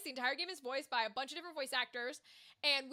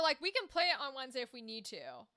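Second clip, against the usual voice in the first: ordinary talk, nothing marked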